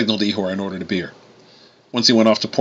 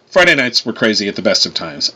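ordinary delivery

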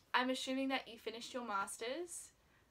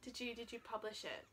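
usual speaking voice

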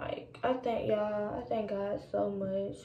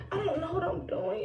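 laughing; 8 semitones higher than usual. Real, not acted. Laughing at herself.

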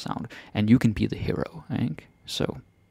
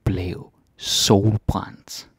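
ordinary talk

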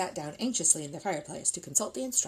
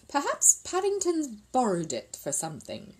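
vague; 7 semitones higher than usual. A narrator's reading. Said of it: "said Missus Brown vaguely".

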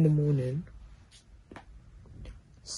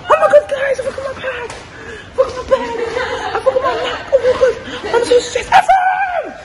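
high, loud, laughing squeal; excited shrieking; 12 dB louder than usual. Real, not acted. Friends greeting with shrieking excitement.

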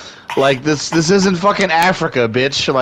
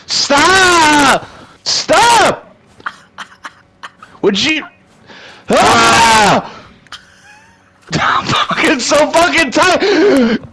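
real rage; 8 semitones higher, much slower than usual. Real, not acted. Gamer losing it at a hated opponent, enraged shouting. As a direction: yell it in real, fed-up fury. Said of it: "That's real anger".

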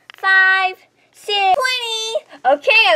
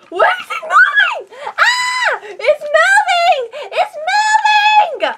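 playful scared shriek; 13 semitones higher, slower than usual. Pretend. Toy helicopter moves unexpectedly.

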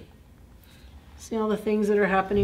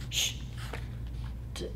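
plain reading voice

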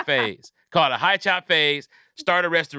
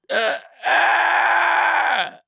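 mock scared cry; 4 dB louder than usual. Fainting goat scare.

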